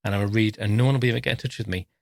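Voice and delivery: quick, eager thoughts tumbling out; hurried daydream of a free day; rushed inner thought. Retold or imitated. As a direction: rattle it off, excited to be alone.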